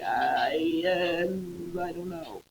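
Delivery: small embarrassed admission; teacher imitating Lucy's sheepish answer. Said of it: "And she's like".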